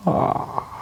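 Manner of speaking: soft breathy demonstration yawn; demo yawn. Shown as a demonstration. Teacher showing students a relaxed yawn, soft and unforced. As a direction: open the face in a gentle yawn. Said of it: "I'll show you an example of that now".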